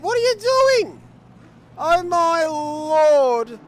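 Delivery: high, loud race call building to outrage; live play-by-play. Real, not acted. Commentator watching cars jump the start, escalating outraged play-by-play. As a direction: call the botched start at rising, incredulous full voice.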